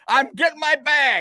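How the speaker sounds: high, drawn-out holler, acting himself; slow, high-pitched mock shout; retold yell. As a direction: yell it back over your shoulder. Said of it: "And I'm yelling".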